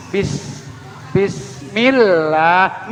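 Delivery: slow "Bismillah" with thin i and long ll; lips drawn back. Shown as a demonstration. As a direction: pull the lips wide on "Bis" and hold it. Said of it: "Bibirnya ditarik". ("Bismillah" tipis, bibir ditarik)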